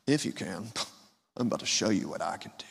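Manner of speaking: hushed, mocking, fast scoff; mock Jesus scoffing at the father; scoffing whisper. Shown as a demonstration. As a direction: sneer it under your breath. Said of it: "this is not a scoff".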